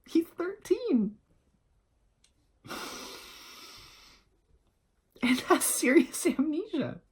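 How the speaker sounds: mock starstruck gush. Mock-gushing the absurd facts.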